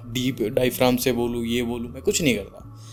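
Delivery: ordinary talk, nothing marked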